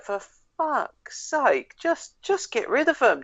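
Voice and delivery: high, drawn-out exasperated groan of words; fed-up queuer's high-pitched whine; acted exasperation. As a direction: plead in mock despair, stretching it out. Voicing impatience of waiting in line.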